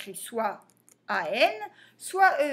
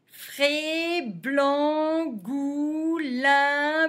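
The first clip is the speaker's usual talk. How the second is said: quicker syllable reading; 3 semitones higher, much slower than usual. Shown as a demonstration. Teacher repeating the list for pupils, quicker and linked. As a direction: run the syllables together in an even chant.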